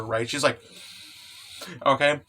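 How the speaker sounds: plain reading voice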